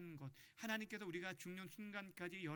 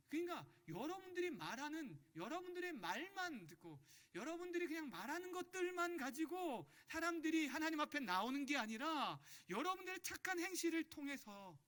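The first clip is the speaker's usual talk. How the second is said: high-pitched, emphatic sermon voice; raised preacher's pitch, urgent and insistent; impassioned preaching; 9 semitones higher, 3 dB louder than usual. Real, not acted. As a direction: drive the point home to the congregation.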